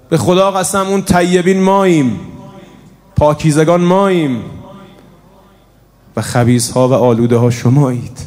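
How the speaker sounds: Shimr's shout. Acted. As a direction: shout it out as the arrogant enemy. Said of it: "شمر با صدای بلند فریاد زد". (فریاد بلند و مغرورانه)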